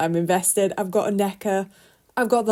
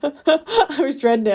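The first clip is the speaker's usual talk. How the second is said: laughing dread; 6 semitones higher, 6 dB louder than usual. Real, not acted. Laughing about dreading cubs.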